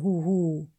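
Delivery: weiches, abfallendes Huhu; Eulenruf. A narrator's reading. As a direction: ruf wie eine Eule. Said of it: "berichtete Onkel Uhu". (sanfter Eulenruf huhu)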